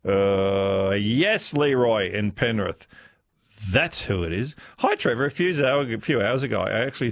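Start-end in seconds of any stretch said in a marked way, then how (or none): none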